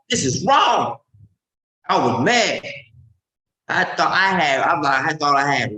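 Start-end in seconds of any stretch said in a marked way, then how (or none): 0.1-1.0 sharp indignant outburst, higher and louder; retold outrage. Watching police shoot a walking kid, shouts in outrage. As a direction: blurt it out like you just saw injustice.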